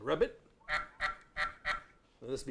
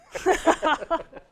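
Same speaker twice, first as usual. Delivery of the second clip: hearty pirate laugh; 12 dB louder than usual. Acted. Laugh after mermaid joke.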